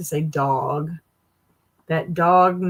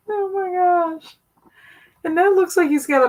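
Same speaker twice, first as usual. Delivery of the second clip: high, wheezing laugh-cry; crying with laughter; 13 semitones higher, 3 dB louder than usual. Real, not acted. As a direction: laugh until tears come.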